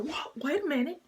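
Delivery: high-pitched startled reaction; mock alarm. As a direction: imitate him caught off guard, voice jumping up. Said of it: "And he's like".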